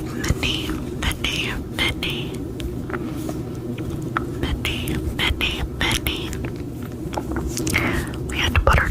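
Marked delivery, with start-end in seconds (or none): 0.0-6.3 tingly asmr mouth sounds. Acted. Trigger word repetition.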